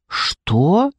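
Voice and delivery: incredulous cry, rising sharply on the question; appalled outburst; shocked exclamation. A narrator's reading. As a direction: exclaim in disbelief, drawing out the protest. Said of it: "воскликнула Дженни".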